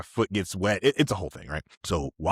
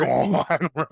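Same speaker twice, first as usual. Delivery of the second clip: cocky biker voice; 10 semitones higher, 6 dB louder, slower than usual. Retold or imitated. Acting himself on the moped.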